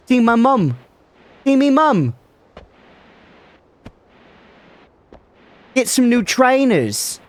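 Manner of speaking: high-pitched British caricature; exaggerated put-on British voice; mock British accent. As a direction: ham up the British accent, drawing out the words. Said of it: "My British accent".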